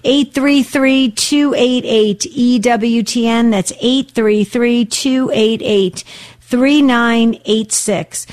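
slow, evenly spaced digits; measured call-in number read; reading out phone number. Real, not acted. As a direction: announce the number slowly for callers to catch.

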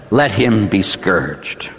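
low, slow pronouncement; resigned Pilate. Acted. Pilate giving up and sentencing Jesus, slow resigned ruling. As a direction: hand down the sentence slowly and low. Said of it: "Pilate gives up and says".

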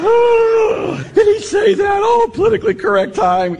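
high, loud shriek of shock; mock shocked outcry. Priest voicing students shocked by his remark, loud and high. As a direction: gasp out a scandalized cry. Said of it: "we go on and go".

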